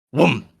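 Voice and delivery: short falling whoom; a single booming whoom; imitated coffin noise. Coffin turns up behind the officer, imitated thud.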